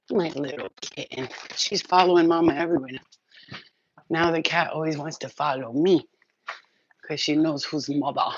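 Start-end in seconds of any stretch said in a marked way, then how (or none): none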